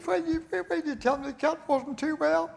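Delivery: high, distressed woman's voice; sick wife scolding her husband, high and upset. Acted. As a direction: wail it like a wife in a hospital bed. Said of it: "She says".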